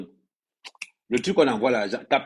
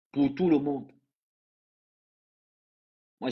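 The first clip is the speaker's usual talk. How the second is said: normal talking voice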